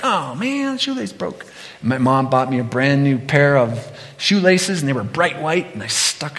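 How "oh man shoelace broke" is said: put-on much higher, whiny little-kid lament; be the boy whose favorite shoelace just snapped; kid's dismay. Preacher voicing his child self over a broken shoelace, whiny. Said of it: "I was like".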